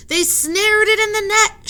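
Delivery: put-on high, loud boy's shout; excited shout. Tommy shouts to the children about the catch, high and loud. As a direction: shout the news to the crowd. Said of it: "shouted Tommy".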